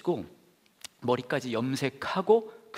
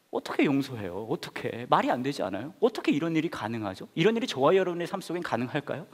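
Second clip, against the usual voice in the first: ordinary talk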